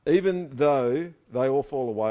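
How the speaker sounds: slow, loud emphatic vow; emphatic re-reading. Acted. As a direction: draw it out loudly, word by word. Re-reading Peter's bold vow.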